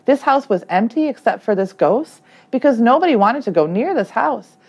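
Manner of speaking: plain reading voice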